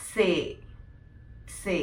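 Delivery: സേ as "tsay"-like "say", long e; German letter C. Shown as a demonstration. Teacher repeating German C for students. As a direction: say സേ like "say", not "see". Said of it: "സി നമ്മൾ pronounce ചെയ്യുന്നത്".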